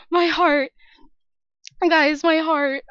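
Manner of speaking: breathless and loud after a scare; real fright, chest pounding; shaken, heart pounding. Real, not acted. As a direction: gasp it out, heart racing.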